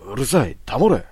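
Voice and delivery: loud, high panicked yell; furious shout. Acted. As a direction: scream at him to shut up. Fugitive screams, waving knife.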